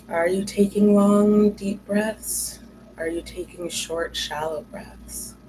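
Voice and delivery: measured meditation guide, long pauses between phrases; guided meditation. Acted. Host leading listeners into awareness of their breath, guided meditation. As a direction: guide listeners through noticing the breath, leaving space after each prompt.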